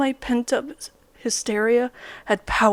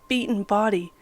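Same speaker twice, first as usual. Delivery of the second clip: usual speaking voice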